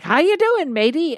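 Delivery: high, cheery Irish "matey" greeting; mock Irish sprite saying hello; Irish leprechaun voice. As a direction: play a grinning little leprechaun. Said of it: "It was like".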